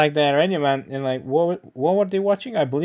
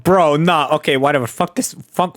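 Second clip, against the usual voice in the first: usual speaking voice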